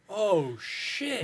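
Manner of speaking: shocked reaction. Retold or imitated. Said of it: "and you just go".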